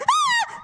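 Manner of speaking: high-pitched mock gasping shriek; sudden scream at a movie scare; imitated startled scream. Sister shows her movie theater fright, mock scream. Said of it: "I scare other people in the movie".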